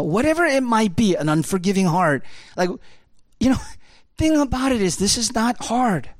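high, fast, rising sermon voice; impassioned preaching. Acted. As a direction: preach it with building fervour.

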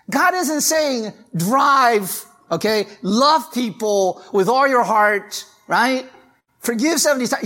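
high, loud, urgent preaching; preaching at full force. Acted. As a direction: drive the point home to the congregation.

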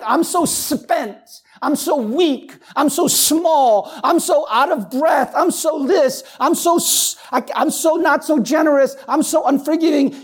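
half-hearted imitated moaning; mock weary complainer. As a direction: play the tired complainer, hammed up. Mock complaint quoted in sermon.

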